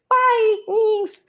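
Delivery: exaggerated high-pitched "Bye, Meegs"; mock sign-off. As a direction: squeak it way up as a mock goodbye. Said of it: "I'm getting fired up and then, like".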